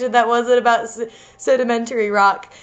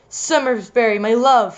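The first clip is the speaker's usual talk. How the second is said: ordinary delivery, nothing marked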